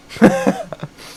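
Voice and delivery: short high-pitched laugh; high giggle. Real, not acted. Streamer laughing at his own tease, high giggle. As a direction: let out a squeaky giggle.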